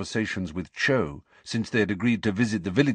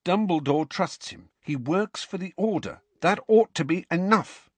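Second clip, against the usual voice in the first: heated; 8 semitones higher than usual. A narrator's reading. Hermione argues, higher.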